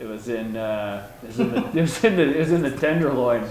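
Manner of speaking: ordinary delivery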